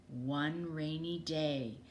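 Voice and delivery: ordinary delivery, nothing marked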